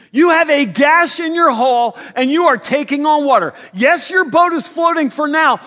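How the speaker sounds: loud, high, alarmed plea; urgent rescue call. Acted. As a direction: shout it like lives depend on it. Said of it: "land with that kind of force and urgency".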